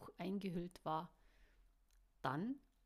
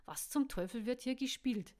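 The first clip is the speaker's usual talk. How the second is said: loud, higher exasperated outburst; 4 semitones higher, 6 dB louder than usual. Acted. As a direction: throw the question out in anger. Caro snorts, exasperated.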